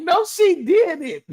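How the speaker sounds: high, squeaky voice of disbelief; stunned disbelief. Retold or imitated. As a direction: sound floored by a child's comeback. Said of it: "Girl, I was like".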